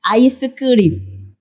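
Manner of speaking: "Ice cream" with Korean sounds, extra vowels, "ai-seu-keu-rim"; Konglish pronunciation. Shown as a demonstration. Showing how a Korean would say "Ice cream", Konglish pronunciation. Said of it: "You will hear something like".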